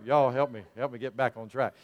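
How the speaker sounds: ordinary talk, nothing marked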